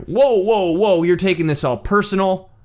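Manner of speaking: high, loud mock indignation; imitated affronted protest; moral high ground. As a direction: back someone off as if wrongly attacked. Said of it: "Moral high ground".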